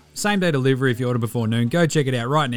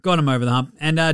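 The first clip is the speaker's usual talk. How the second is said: normal talking voice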